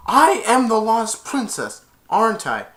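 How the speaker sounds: retold fierce outburst; defiant. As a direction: stand up to the mother at last. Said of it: "And she said".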